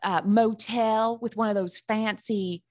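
drawling, hammed-up Southern accent. Shown as a demonstration. Co-host describing a night out, Southern accent. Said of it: "I have a really good one".

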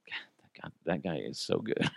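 breathless whisper of admiration; hushed and amazed under the breath; awed whisper. Retold or imitated. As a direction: whisper it to yourself, starstruck. Awed muttering about buddy.

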